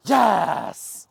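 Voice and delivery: loud praise shout. Real, not acted. Trainer praising returning dog, loud. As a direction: reward him with a big yes.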